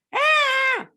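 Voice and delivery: high-pitched loud yelp; short, high imitated shriek; imitated child's yell. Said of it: "mas fala porque gritou".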